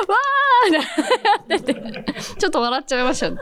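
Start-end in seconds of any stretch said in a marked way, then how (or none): 0.1-0.7 imitating a cheer, much higher and louder; a hammed-up celebratory whoop; mock cheer. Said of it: "後輩の上垣くんがね". (後輩の歓声を高く大きく真似)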